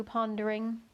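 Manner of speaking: usual speaking voice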